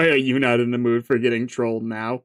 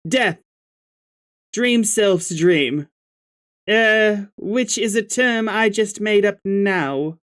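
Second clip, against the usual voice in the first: put-on higher, brighter girl character, annoyed; Jade voice; 9 semitones higher than usual. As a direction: voice a cranky young girl, a bit higher. Jade replies, upset.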